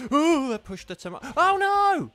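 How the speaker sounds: high, loud wail of dismay at the game; dismayed cry. Real, not acted. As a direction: cry out as the tomato slides off. Game knocks tomato off pick.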